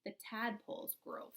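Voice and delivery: put-on slow, expressive read-aloud for young children; storybook reading. Teacher reading a frog book to her class, storybook voice.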